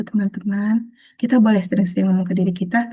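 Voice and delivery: ordinary delivery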